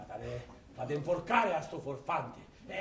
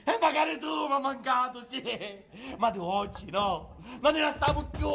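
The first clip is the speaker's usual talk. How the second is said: high manic joy; 8 semitones higher, 6 dB louder than usual. Acted. Talks lovingly to his casket.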